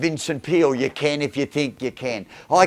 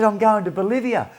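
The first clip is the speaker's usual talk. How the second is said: excited daughter; 8 semitones higher than usual. Retold or imitated. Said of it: "and all excited".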